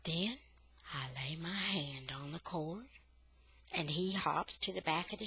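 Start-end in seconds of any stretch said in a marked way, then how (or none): none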